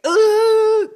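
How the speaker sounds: loud high ooh of despair; anguished ooh. Retold or imitated. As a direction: wail it out. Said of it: "it's like".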